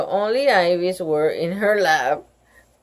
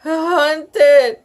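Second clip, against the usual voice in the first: high, tearful wail; child wailing to her teacher; 7 semitones higher, 5 dB louder, much slower than usual. A narrator's reading. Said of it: "she wailed suddenly".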